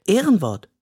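solemn little promise. A narrator's reading. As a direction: swear it like a child. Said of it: "verspricht Yoshi".

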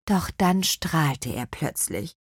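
ordinary delivery

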